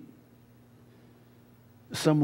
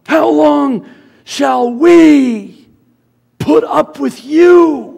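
furious drawn-out yell, much higher and louder; angry shout; 14 semitones higher, 14 dB louder, much slower than usual. Retold or imitated. Moses shouting angrily at the complaining Israelites, slow furious yell. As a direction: bellow it slowly in rage. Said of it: "And in his anger".

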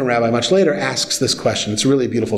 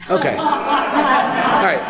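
high laugh before an amused all right; 11 semitones higher, much slower than usual. Real, not acted. Laughs at daughter's cute answer.

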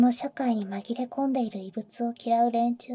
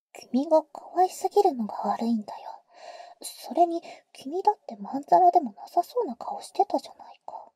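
teasing whisper; 4 semitones higher than usual. Acted. Older sister teasing the boy in his ear, whisper.

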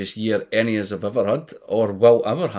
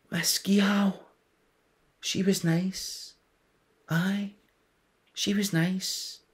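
soft and fond; 7 semitones higher, 4 dB quieter, much slower than usual. Acted. Fond memory of teacher.

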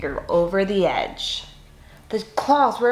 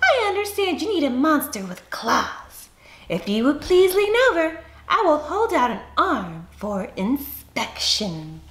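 a prim monster introducing himself, high and unhurried; high, silky voice; 8 semitones higher than usual. Acted. Groomed monster Ralph offers his claws, high and silky. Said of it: "he said in a high, silky voice".

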